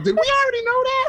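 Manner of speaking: very high, incredulous question; retold stunned squeal; shocked. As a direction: re-enact frantically checking with friends. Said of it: "I was so shocked".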